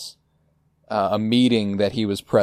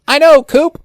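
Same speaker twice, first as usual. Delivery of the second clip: very high, loud shout; Gordon shouting; 10 dB louder than usual. Retold or imitated. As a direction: bellow it like a hard-of-hearing boss. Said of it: "Gordon goes".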